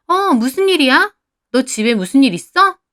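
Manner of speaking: eager, high-pitched questioning; thrilled to hear there may be trouble; excited prying. A narrator's reading. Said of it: "들떠서 물어보는 그 목소리가".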